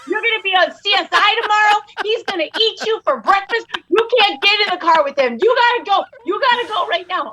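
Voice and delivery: high, urgent mock panic; Bone's panicked warning. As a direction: comedian friend warning her to hide, high and frantic. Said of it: "He goes".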